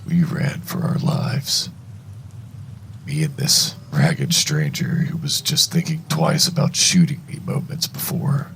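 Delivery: ordinary delivery, nothing marked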